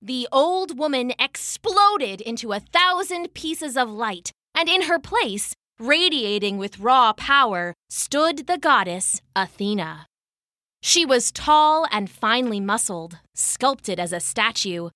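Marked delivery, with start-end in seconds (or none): none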